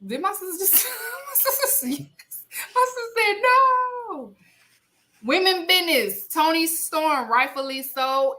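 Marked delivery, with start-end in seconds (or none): 0.1-4.3 high, laughing disbelief; giggling in disbelief. Real, not acted. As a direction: crack up mid-sentence. Laughing at sister's reply.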